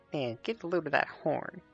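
tiny high pixie voice, softly; light squeaky fairy whisper. Acted. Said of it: "a tiny voice somewhere say".

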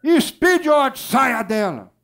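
high, loud rebuke; forceful shout, pitch high then falling; shouted exorcism command. Retold or imitated. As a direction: yell the spirit out. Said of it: "o irmão gritou lá no meu ouvido".

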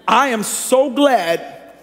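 am so glad stretched very slowly; drawn-out emphasis. Real, not acted. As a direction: linger on so glad. Preacher emphasis.

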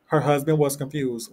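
usual speaking voice

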